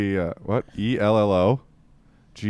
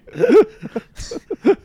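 very high, loud burst of laughter; 7 dB louder than usual. Real, not acted. Guy cracking up at his own dick joke. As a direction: shriek with laughter.

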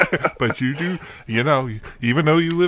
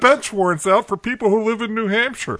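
high, breezy mock official voice; mock bureaucrat; 7 semitones higher than usual. Mocking the Massachusetts town, a high careless clerk voice. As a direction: play a careless clerk dreaming up warrants. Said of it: "I just figure".

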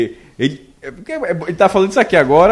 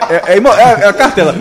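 ordinary talk, nothing marked

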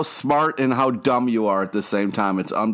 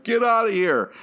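mock shout; 11 semitones higher than usual. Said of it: "And then she's like".

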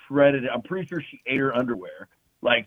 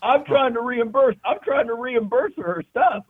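defensive protest; 10 semitones higher than usual. Real, not acted. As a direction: insist you meant well, pitched up. Defending himself, laughing protest.

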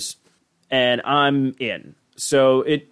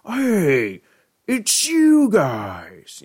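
high, drawn-out creature voice; Scary Terry voice; 3 semitones higher, much slower than usual. Retold or imitated. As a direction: stretch out hey it's you guys. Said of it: "love Scary Terry with his".

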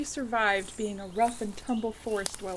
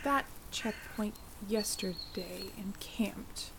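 whispering; 3 semitones lower, 9 dB quieter, slower than usual. Real, not acted. Quiet talk at summit.

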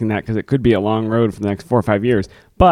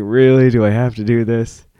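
reluctant whine. Retold or imitated. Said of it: "They're saying".

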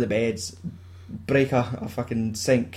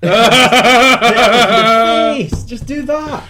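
high, loud, laughing protest; exasperated shout; 10 semitones higher, 10 dB louder, much slower than usual. Real, not acted. Teller rewrites the cheesy scene, shouting in laughing exasperation. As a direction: drag the words out in loud disbelief.

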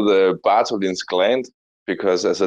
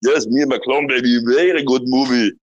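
gleeful Austrian action-star voice with big laughs; hammed-up mock-Austrian accent, swooping up and down; Arnold; 3 semitones higher than usual. Playing along about the movie, gleeful Arnold impersonation. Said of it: "Arnold impersonation".

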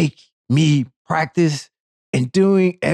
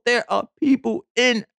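indignant; 9 semitones higher than usual. Real, not acted. Indignant about seat takers.

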